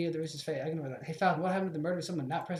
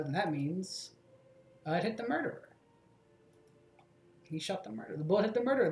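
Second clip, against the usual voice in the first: ordinary talk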